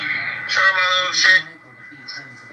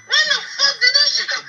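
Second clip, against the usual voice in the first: laughing disbelief; 3 dB louder than usual. Retold or imitated. Retelling her asking to be paid.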